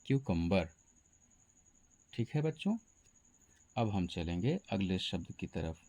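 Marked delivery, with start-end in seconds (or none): 0.1-0.7 क्यूकम्बर said KYOO-kum-bar; correct pronunciation. Shown as a demonstration. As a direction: begin क्यूकम्बर with a clear KYOO. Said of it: "सही उच्चारण होता है". (क्यूकम्बर का सही उच्चारण, 'क्यू' से शुरू)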